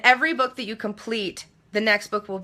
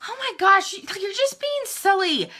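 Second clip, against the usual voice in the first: pissy; 10 semitones higher than usual. Retold or imitated. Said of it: "I get kind of pissy about it".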